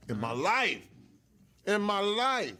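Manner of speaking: loud, incredulous emphasis; emphatic insistence. Real, not acted. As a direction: hammer home each phrase loudly. Emphatic disbelief at paying.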